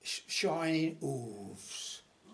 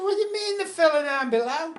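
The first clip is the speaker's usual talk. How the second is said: incredulous landlord; 12 semitones higher, 10 dB louder, faster than usual. Acted. Landlord's indignant retort to Patrick.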